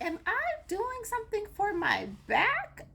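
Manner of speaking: hammed-up puzzlement at herself; confused. Retelling her gym confusion to her husband, mock-baffled. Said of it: "so now I'm very confused".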